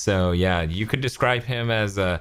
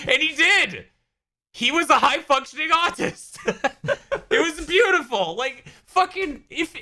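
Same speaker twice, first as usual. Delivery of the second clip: laughing. Real, not acted. Laughing at his own joke.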